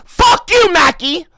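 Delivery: screaming high and loud in outrage; full-throated indignant scream; furious shout. Real, not acted. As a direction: yell it back, deeply offended. Angry outburst at chat insult.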